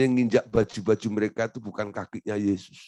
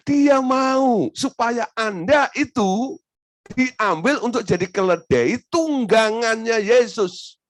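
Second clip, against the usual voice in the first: high, loud calling out to the crowd; preaching at full force; 12 semitones higher, 5 dB louder, slower than usual. Acted. Preacher calling out to the congregation, raised and loud.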